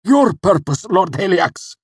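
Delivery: very high, loud, choked cry. A narrator's reading. Cinder swearing service to Haliax, choking it out. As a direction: choke out the answer through pain. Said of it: "The words were choked out".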